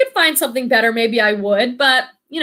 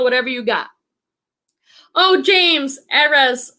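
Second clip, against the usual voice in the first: ordinary delivery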